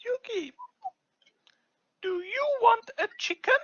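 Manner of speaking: high sing-song puppet question. Pretend. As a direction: coax the child as a puppet. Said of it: "puppet offering food".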